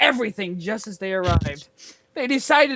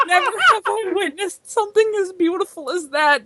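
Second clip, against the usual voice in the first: awed character voice; 10 semitones higher than usual. Acted.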